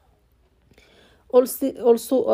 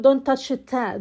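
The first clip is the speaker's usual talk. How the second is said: normal talking voice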